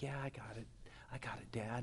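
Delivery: low, near-whispered reply; subdued son giving in; chastened mumble. Retold or imitated. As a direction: answer Dad small and quiet. Chastened son answers his dad.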